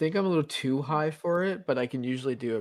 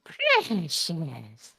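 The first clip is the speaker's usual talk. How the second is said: Gollum voice; 5 semitones higher, 4 dB louder than usual. Acted.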